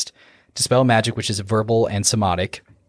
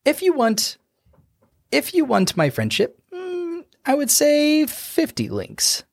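sly halfling voice. Acted. Halfling naming his price to the dwarf, high and sly.

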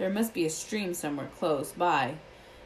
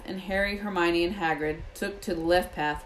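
plain reading voice

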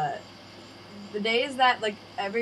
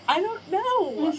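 high, slow helpless reply; drawn-out, high, helpless I-don't-know; helpless shrug; 9 semitones higher, 4 dB louder, much slower than usual. Retold or imitated. Daughter answering angry dad, slow high helpless shrug.

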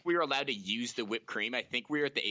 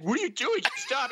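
scared cousin; 5 dB louder than usual. Retold or imitated. Cousin voiced in story.

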